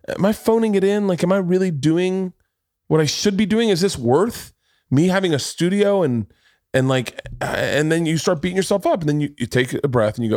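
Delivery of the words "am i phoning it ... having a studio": high, worried self-doubt; pitched-up anxious inner voice; anxious self-questioning. Retold or imitated. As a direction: fret aloud in a higher voice.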